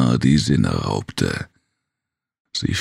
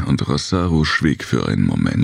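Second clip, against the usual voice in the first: ordinary delivery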